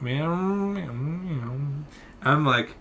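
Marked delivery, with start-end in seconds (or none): 0.0-1.6 soft, wordless mumbling; mock a muffled voice behind a mask; mimicked unintelligible mumble. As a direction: murmur it so nothing can be understood. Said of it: "just going".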